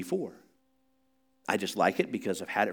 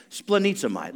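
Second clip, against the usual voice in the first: urging repeat; 7 semitones higher than usual. Shown as a demonstration. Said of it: "Say it".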